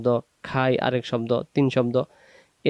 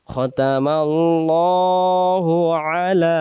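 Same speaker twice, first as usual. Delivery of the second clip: খতামাল্লাহু আলা chanted, slow and a bit higher; slow recitation; 3 semitones higher, slower than usual. Shown as a demonstration. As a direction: chant the phrase slowly. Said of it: "এটা শব্দ আলা". (ধীরে সুর করে তেলাওয়াত)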